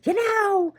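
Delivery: high, loud mock yell; shouting out. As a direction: shout to stop him leaving. Retelling a fight, calling out.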